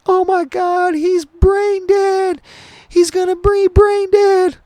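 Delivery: very high, loud, drawn-out falsetto wail; slow mock shriek of despair; imitating hysterical mom. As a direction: imitate a mother screaming terrible news. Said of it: "just fucking bawling and crying".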